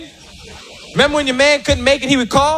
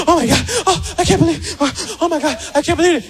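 breathless ecstasy; 5 semitones higher, faster than usual. Retold or imitated. Woman on bed in ecstasy.

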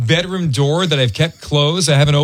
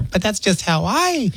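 mock wife defense; 3 semitones higher, 4 dB quieter, slower than usual. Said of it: "And Leilani's sitting there".

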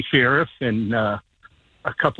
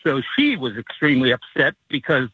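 usual speaking voice

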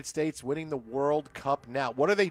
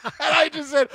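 bursting into high laughter; 9 semitones higher, 5 dB louder than usual. Real, not acted. Host cracking up retelling the nose story, high laugh. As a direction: laugh out loud at the memory. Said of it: "laughs at his own story".

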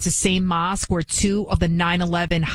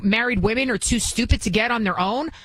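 ordinary talk

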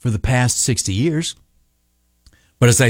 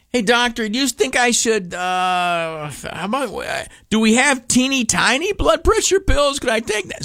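higher, small sheepish request; sheepish asking; 9 semitones higher than usual. Retold or imitated. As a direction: sheepishly wheedle for tiny pills. Sheepishly asking doctor again.

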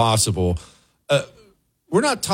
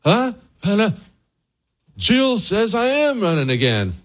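put-on higher, puzzled old man voice; mock confused Biden; 11 semitones higher than usual. Host mocking Biden insisting he'll run, higher and confused. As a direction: play him lost and protesting.

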